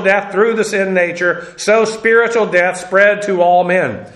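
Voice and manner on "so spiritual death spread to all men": normal talking voice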